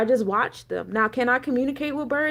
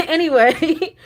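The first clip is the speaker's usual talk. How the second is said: 1 laughing; 6 semitones higher, 6 dB louder, slower than usual. Real, not acted. Laughs back into the story.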